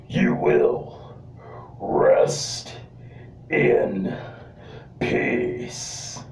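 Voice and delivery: slow, breathy, menacing whispered threat; Undertaker impersonation. Retold or imitated. Wrestler threatening his opponent, slow ominous whisper. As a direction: whisper it like a graveyard warning. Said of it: "I can do an Undertaker impersonation".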